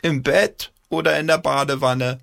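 teasing grandma call from afar. A narrator's reading. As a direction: call back playfully. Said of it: "Eine Oma-Stimme schallte aus der Küche".

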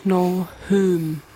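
slightly Saxon-tinged pronunciation; Saxon-sounding. Real, not acted. Moderator names the bonus card, a bit Saxon-sounding. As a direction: say it with a hint of Saxon. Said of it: "klingt irgendwie immer ein bisschen sächsisch".

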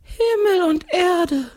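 very high, slow drawn-out ghostly cry; exclaiming ghost. A narrator's reading. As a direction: echo it in long wailing wonder. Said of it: "ruft der Geist".

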